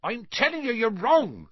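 loud, high, heated contradiction; heated outburst; hot-tempered. A narrator's reading. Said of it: "said Hagrid hotly".

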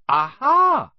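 loud, high cry of discovery; sudden loud, high exclamation; triumphant realisation. A narrator's reading. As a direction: pounce on the slip in triumph. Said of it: "said Harry".

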